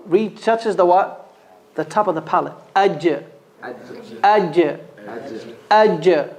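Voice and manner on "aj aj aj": ordinary talk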